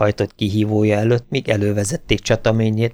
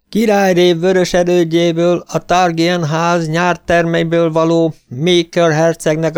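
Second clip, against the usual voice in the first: higher, louder ceremonial announcement; herald proclaiming; 7 semitones higher, 4 dB louder than usual. A narrator's reading. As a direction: announce the titles to the crowd. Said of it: "kiáltotta egy herold".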